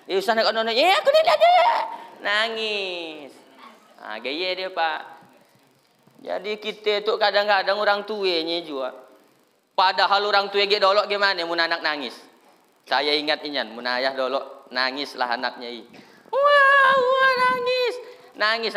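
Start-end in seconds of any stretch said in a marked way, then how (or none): 0.8-1.5 put-on high squeaky kid voice, louder; child voice. Child replies in play. 16.3-17.9 put-on high wailing baby cry.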